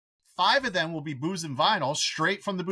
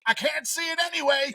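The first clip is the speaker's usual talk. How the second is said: imitating a little kid; 12 semitones higher than usual. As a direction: play the delighted three-year-old. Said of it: "you get up and be like".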